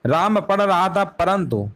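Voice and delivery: slowed down to stress the example; careful teacher read; slow emphasis. Shown as a demonstration. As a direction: drag out राम पढ़ रहा था परंतु for the students. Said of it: "simple सा है".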